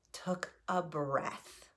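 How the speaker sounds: hushed, slow whisper. Retold or imitated. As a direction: breathe the words out slowly. Recalls calming breath on stage.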